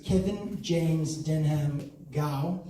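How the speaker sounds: slow announcement. Shown as a demonstration. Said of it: "Mouthful, right?".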